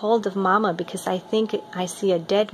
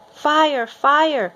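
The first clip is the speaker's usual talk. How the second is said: loud, higher alarm cry; shouting fire; 7 semitones higher, 6 dB louder than usual. Acted. Alarm shouted during night fire.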